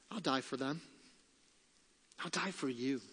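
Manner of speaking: softly voiced promise of Jesus; tender, quiet. Preacher voicing Jesus's promise to die for sinners, quiet.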